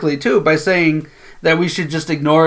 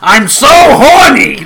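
loud, high, drawn-out squawk; Gilbert Gottfried; 8 semitones higher, 7 dB louder, much slower than usual. Retold or imitated. Co-host imagining a comedian saying Andy's line, loud squawking impression. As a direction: yell it slowly in an abrasive high comic voice. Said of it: "Gilbert Gottfried".

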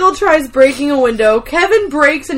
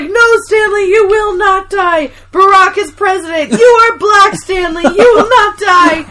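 high, louder mock pleading shout; dramatic Michael; 6 semitones higher, 3 dB louder than usual. Michael over collapsed Stanley, hammed-up pleading shout. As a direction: beg him not to die, hammed-up. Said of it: "he's like".

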